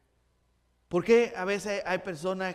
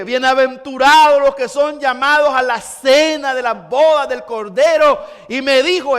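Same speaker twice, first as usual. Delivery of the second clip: rising, louder preacher's call to repentance; fervent preaching; 4 semitones higher, 9 dB louder than usual. Acted. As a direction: preach it with full conviction. (predicando con fervor y más fuerte)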